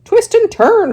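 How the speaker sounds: the goose honks orders, very high and loud; honking goose. Acted. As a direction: honk it urgently. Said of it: "honked the goose".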